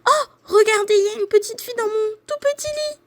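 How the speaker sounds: very high, fast, loud squeal; squeaky baby bear, racing along; piercing little bear. A narrator's reading. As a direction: shriek the discovery like a tiny bear. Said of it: "crie de sa petite voix perçante".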